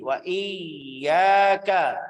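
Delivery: very slow Wa iyyaka, yy held; doubled y. Shown as a demonstration. Teacher models iyyaka with tasydid. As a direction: draw out the yy of iyyaka. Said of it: "Ya tasydid".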